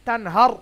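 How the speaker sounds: তানহার, loud with a heavy, full final "r"; thick ra. Shown as a demonstration. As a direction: make the last "r" of তানহার thick. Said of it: "র মোটা হবে".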